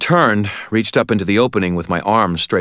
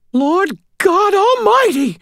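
loud, very high, drawn-out exclamation; shocked old lady; 6 dB louder, slower than usual. A narrator's reading. Old lady learning neighbours are in danger, shocked squeaky cry.

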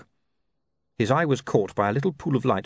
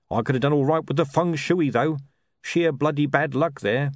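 higher-pitched hawker bemoaning his luck; hard-done-by sausage seller; 5 semitones higher than usual. Acted. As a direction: play an unlucky street trader, pitched up.

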